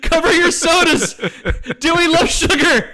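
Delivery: high, loud frantic screaming with laughs; mock screaming. Retelling Jackie screaming at the crowd while running in circles, mock scream. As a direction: scream warnings while cracking up. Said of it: "screaming, crying".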